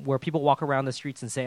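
ordinary talk, nothing marked

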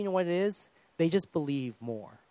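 ordinary delivery

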